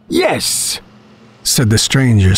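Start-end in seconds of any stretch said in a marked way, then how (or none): 0.1-0.8 high, soft-edged yes through a smile; vague smile. A narrator's reading. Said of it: "smiling vaguely".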